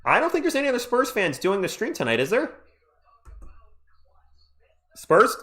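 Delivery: ordinary talk, nothing marked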